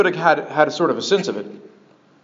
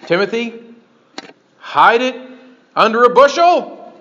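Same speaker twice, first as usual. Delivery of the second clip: higher, louder rhetorical challenge; mock incredulous question; 6 semitones higher, 4 dB louder than usual. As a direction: build to an absurd question for the crowd. Mock question, expecting a loud no.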